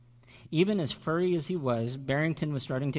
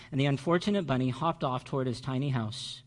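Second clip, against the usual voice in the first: ordinary delivery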